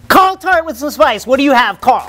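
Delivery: high, hyped emcee call; announcer handoff. Pretend. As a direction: throw to the guest with big energy. Host throws to guest like announcer.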